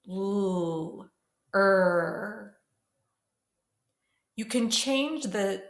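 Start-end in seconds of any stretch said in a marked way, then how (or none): 0.1-1.1 sustained, isolated "L"; L sound. Shown as a demonstration. Teacher shows class where the tongue goes, held L sound. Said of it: "Now let's try it together". 1.6-2.6 sustained, isolated "Er"; R sound. Shown as a demonstration. Teacher shows class the tongue position, held R sound. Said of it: "Now let's try it together".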